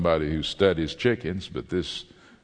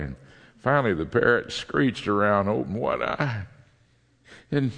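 ordinary talk

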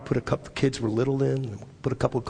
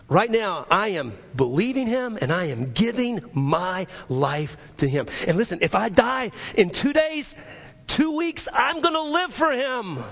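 impassioned vow; 11 semitones higher than usual. Retold or imitated. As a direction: retell a dying convert's vow, building to full fervor. Retold dying man's impassioned vow.

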